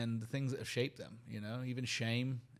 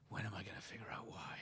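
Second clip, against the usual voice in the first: breathy whisper, retelling his younger self asking; whispered wondering; 9 dB quieter, faster than usual. Retold or imitated. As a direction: whisper it as if asking yourself at night. Retold teenage self wondering.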